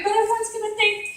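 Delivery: high-pitched retold protest; mock objection. Retold worried objection to actor.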